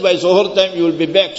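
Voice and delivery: ordinary delivery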